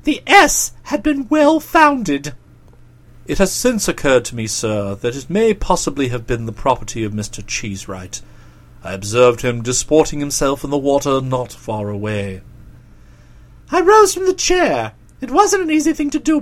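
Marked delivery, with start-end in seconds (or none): 3.3-12.4 very low, measured valet voice; low-pitched, discreet manservant; deferential valet. Acted. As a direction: report respectfully in a deep voice, dropping softly on "sir". Jeeves the valet reports.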